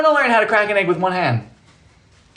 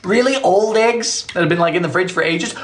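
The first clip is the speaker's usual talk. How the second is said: ordinary talk, nothing marked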